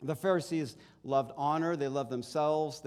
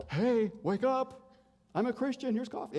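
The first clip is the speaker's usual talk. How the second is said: mock cheerful wake-up; 8 semitones higher than usual. Pastor imagining waking the pickup driver, high playful call. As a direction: play it as a goofy do-gooder.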